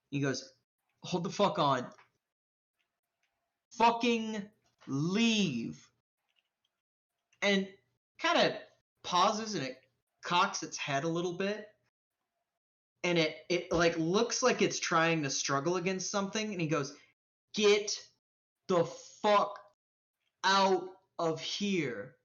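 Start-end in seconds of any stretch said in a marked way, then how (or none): 1.0-5.9 menacing command. Acted. Stranger orders the creature away. 17.5-22.2 slow, separated, forceful words; menacing command. Acted. Stranger orders the creature away.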